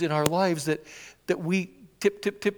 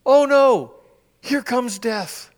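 panicked dread; 6 semitones higher, 9 dB louder, much slower than usual. Retold or imitated. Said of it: "They're fighting for their lives".

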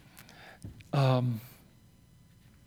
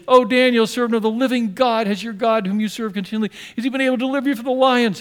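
higher, louder, fast anguished call of a king; anguished cry; 5 semitones higher, 4 dB louder than usual. Acted. Pagan king calling into the lions' den, anguished cry. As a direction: cry out to Daniel in dread. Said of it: "with a tone of anguish".

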